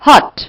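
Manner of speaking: हॉट said as one clear beat for a clap; syllable demo. Shown as a demonstration. Teacher to students, clapping out हॉट as one syllable. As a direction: sound out हॉट as a single syllable, loud. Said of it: "Can you hear me?".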